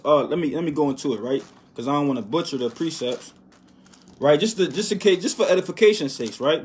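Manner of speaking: usual speaking voice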